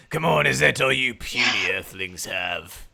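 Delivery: loud, sneering taunt at earthlings; booming arrogant alien overlord. Acted. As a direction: boom it out like a smug conqueror. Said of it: "he's basically just gonna stand there and say".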